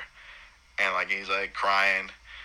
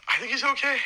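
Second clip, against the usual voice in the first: much higher, breathless relief; relieved. Retold or imitated. Father hears his newborn cry, high relieved voice. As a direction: let the relief squeak out high. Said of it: "I'm like".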